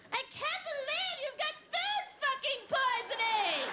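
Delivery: slow, high shocked outburst. Retold or imitated. Melanie loudly breaking silence.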